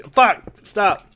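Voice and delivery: sudden loud, high-pitched cursing; frustrated outburst. Real, not acted. Player curses as his building goes wrong, frustrated outburst. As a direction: burst out at the game in exasperation.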